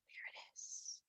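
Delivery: breathy hushed reveal; whisper. Acted. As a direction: whisper as if spotting it far off.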